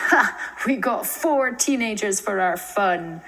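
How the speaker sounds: put-on loud, gloating old killer; gleeful villain. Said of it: "Delighted, the old man exclaimed".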